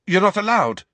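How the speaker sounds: high, loud quick retort; Dudley voice. A narrator's reading. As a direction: blurt it at once. Dudley blurts.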